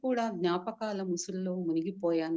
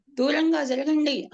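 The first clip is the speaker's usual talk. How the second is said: much higher, louder angry cry; impatient shout; 7 semitones higher, 8 dB louder than usual. Acted. Dying man shouts at family crowding him, impatient shout. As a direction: push them away with a shout. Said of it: "అని అసహనంగా అరిచాను".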